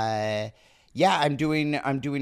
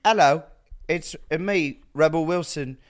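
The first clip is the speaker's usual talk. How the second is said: loud, high, badly faked Australian gone British; hammed-up British accent, building to a shout; Rebel Wilson; 6 semitones higher, 6 dB louder than usual. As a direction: introduce yourself grandly in a botched British accent. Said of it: "It's sort of British".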